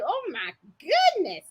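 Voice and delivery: high swooping amused dismay. Retold or imitated. Seamstress retelling her shock at her sewing, amused dismay. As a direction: exclaim it in mock dismay. Retold reaction to own botched sewing.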